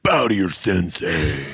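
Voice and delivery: slow, lightly voiced cocky sensei; Rex Kwon Do impression. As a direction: command it slowly like a dojo instructor.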